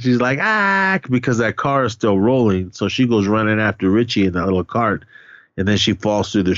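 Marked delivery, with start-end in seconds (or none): 0.4-1.0 high held "ah" shriek; mock freak-out shriek. Retelling Liz seeing Richie hit, mock freak-out shriek. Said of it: "Liz starts flipping out".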